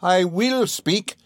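very high, slow, every word hammered; defiant declaration. Acted. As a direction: refuse to be silenced. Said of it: "turning on me with her lightning eyes".

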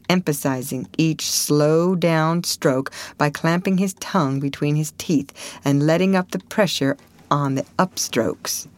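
ordinary delivery, nothing marked

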